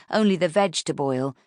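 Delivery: ordinary delivery, nothing marked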